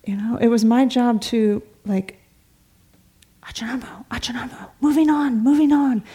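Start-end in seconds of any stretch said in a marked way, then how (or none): 3.4-4.7 soft, near-whispered call of a name; discreetly trying to get his attention, much softer; hushed nudge. Retold or imitated. Nudging a talkative monk. 4.8-6.0 higher, louder hurry-up; urging along. Retold or imitated. Hurrying a talkative monk along.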